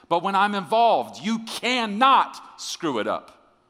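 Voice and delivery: high, loud, stretched-out declaration; emphatic shout. Retold or imitated. God insisting to the preacher, shouted emphatically. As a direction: proclaim it at full force, voice way up.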